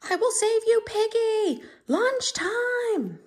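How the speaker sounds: high, loud heroic cry; high, loud storybook rescue cry; excited character shout. Acted.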